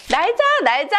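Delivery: high, eager little chant; eager self-urging. Acted. Giraffe trying hard to fly, eager self-urging.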